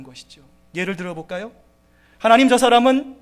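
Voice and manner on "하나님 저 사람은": higher, louder bitter complaint; complaining to God. Retold or imitated. A believer complaining to God, bitter and louder. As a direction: whine at God in frustration. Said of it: "불평할 수 있다라는 거죠".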